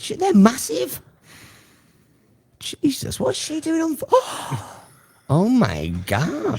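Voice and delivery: high, slowed astonishment with a gasp; jaw-dropped disbelief at the photo; real shock at a photo; stunned. Real, not acted.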